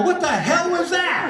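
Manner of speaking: high, slow, drawn-out panicked question; comic panic. Acted. Passenger to pilot after the climb, high mock panic.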